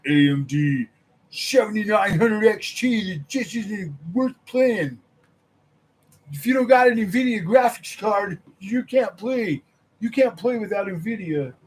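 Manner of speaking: hammed-up brand zealot yelling; mocking fanboy. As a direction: parody an nvidia fanboy preaching. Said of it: "I'm like".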